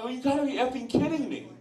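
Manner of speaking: incredulous retort. Retold or imitated. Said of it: "I'm like".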